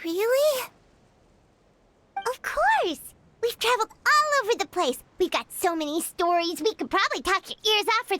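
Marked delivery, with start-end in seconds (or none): 0.0-5.0 excited. Acted. Clairvy thrilled by offer to hear stories.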